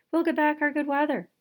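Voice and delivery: the townsfolk cheering they will get good weather back; hopeful cheer. A narrator's reading. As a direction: burst out with hope. Crowd cheers hope.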